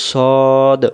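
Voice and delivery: "সদ" as one long, round "sawd"; "সদ" drawn out without any W glide; rounded-mouth pronunciation. Shown as a demonstration. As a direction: say "সদ" with rounded lips held through the vowel. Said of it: "মুখটা গোল হবে".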